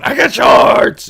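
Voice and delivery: very high, loud, slow yell of shorts; excited shout. Retold or imitated. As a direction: burst out with glee at strangers. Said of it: "running up to 'em going".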